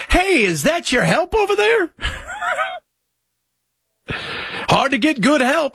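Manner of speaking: yelling it like a clueless bigot; mock shouted quote. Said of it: "walking around going".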